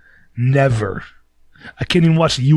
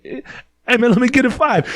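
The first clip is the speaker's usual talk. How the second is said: high, wheedling beggar voice; panhandler asking; 12 semitones higher than usual. Retold or imitated. Bum asks the stranger for a five in a high voice. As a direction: play the bum begging for a five.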